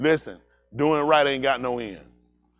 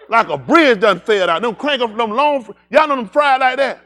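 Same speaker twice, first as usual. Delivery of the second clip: excited; 5 semitones higher, 6 dB louder than usual. Real, not acted. Rhapsodizing about the burger.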